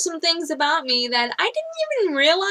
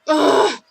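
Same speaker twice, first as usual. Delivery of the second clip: loud frustrated "ugh"; exasperated groan; 7 dB louder than usual. Real, not acted. Venting about a lie over siblings, exasperated groan. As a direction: groan it out in annoyance. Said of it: "makes me so mad".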